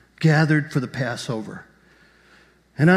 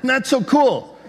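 high, emphatic comic threat; hammed-up indignant threat, pitched high; mock threat; 8 semitones higher, 4 dB louder than usual. Acted threat from the leaders.

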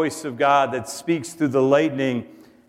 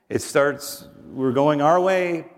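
ordinary talk, nothing marked

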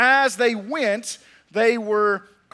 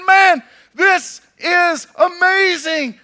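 high, loud, slow exclamation; amazed shout; 8 semitones higher, 9 dB louder, much slower than usual. Acted. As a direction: shout out in wonder, drawn out. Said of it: "they were all excited about their healing".

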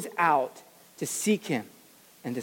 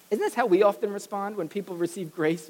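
normal talking voice

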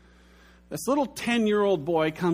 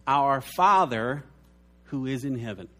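reverent prayer; 3 semitones lower than usual. Retold or imitated. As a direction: intone it gently like the start of the Lord's Prayer. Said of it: "He said to pray like this".

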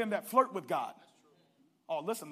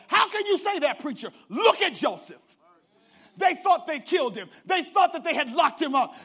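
shouting, building preacher cadence; full-force preaching; 5 semitones higher, 11 dB louder than usual. Acted. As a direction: preach it at the top of your lungs.

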